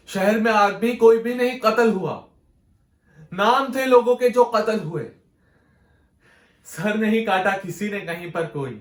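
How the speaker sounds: loud, high poetic outcry; impassioned declamation. Acted. Poet protesting killings in the city, impassioned declamation. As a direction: declaim it forcefully to the whole hall.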